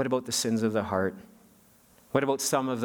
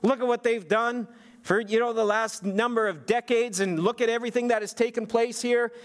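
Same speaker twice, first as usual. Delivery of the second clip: self-righteous; 9 semitones higher than usual. Retold or imitated. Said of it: "all self-righteously and saying".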